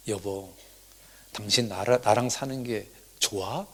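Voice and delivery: soft, low-key acted question; tentative husband. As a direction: ask your wife gently, almost under your breath. Husband hesitantly asks praying wife.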